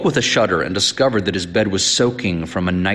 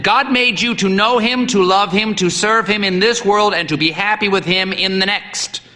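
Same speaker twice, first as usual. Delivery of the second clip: battering voice; 10 semitones higher than usual. A narrator's reading. Old priest battering the boy with the catechism. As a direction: hammer each phrase home. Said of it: "in a battering voice".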